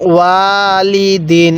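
ওয়ালিদিন very slow, vowels held level; recitation model. Shown as a demonstration. Teacher showing the learner how to read, drawn-out ওয়ালিদিন. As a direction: chant ওয়ালিদিন with a long first syllable. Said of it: "আমার সাথে আরেকবার পড়বেন".

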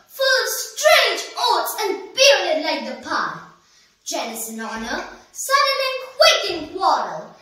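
loud, high, martial declamation dropping away at the end; poem recitation. Acted. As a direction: declaim the soldier's part with force. Recites the soldier's age.

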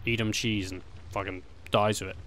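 normal talking voice

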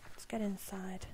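unvoiced whisper. Real, not acted. Player whispering to herself to go inside. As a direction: say it under the breath, no voice. Muttering to self.

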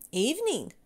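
plain reading voice

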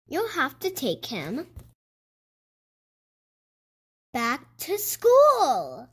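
gentle picture-book storytelling pace; reading to children. A narrator's reading. Elias reading a silly school book to young viewers, storybook read-aloud. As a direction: read it like bedtime for little ones.

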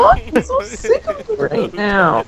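high, slow whining complaint; whiny voice. Retold or imitated. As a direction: whine it out like a sulky kid. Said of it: "in the whiny voice".

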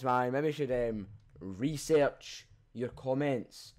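ordinary talk

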